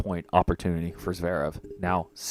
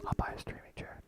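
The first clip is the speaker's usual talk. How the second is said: whisper; 13 dB quieter than usual. Real, not acted. Whispered aside after girlfriend says no.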